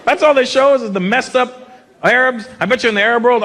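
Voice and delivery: usual speaking voice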